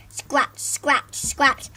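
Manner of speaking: plain reading voice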